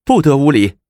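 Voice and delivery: sharp loud command to stop; stern shout. Acted. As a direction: bark it out to halt the nuns. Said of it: "向众尼喝道".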